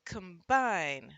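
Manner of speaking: "combine" with a weak schwa start and a strong second syllable; schwa, second syllable stressed. Shown as a demonstration. Teacher demonstrates the schwa, second syllable of "combine" stressed. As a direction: reduce the first vowel of "combine", punch the rest. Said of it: "first syllable often gets the schwa sound".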